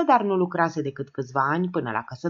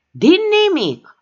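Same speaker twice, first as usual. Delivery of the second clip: slow, much higher and louder roar; furious bellow, much higher; 13 semitones higher, 8 dB louder, slower than usual. A narrator's reading. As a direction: bellow it in fury. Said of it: "răcni Gigeta".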